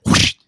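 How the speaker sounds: loud breathy swoosh of a swing; imitated club swing whoosh. Golfer mimics the driver swing for the host, loud whoosh. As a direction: blow the sound of the swing out hard. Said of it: "the guy goes".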